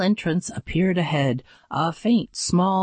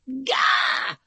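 startled yelp of fright; short startled gah; scream; 7 semitones higher than usual. A narrator's reading. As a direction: cry out in sudden shock. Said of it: "We screamed".

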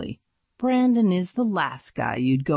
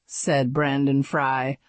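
ordinary delivery

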